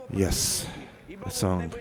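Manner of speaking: usual speaking voice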